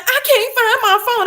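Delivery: high mock whine of a teen; whiny daughter. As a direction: mimic the daughter's panicked whine. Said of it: "And she like".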